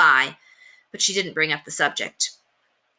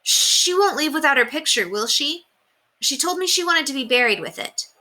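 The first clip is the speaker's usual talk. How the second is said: put-on worried boy; 10 semitones higher than usual. A narrator's reading.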